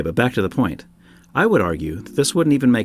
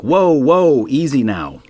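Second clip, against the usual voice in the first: loud, higher "whoa, whoa", then calming down; startled, pretending to talk someone down; hammed-up startled reaction; mock alarm; 5 semitones higher, 4 dB louder, slower than usual. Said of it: "And I was like".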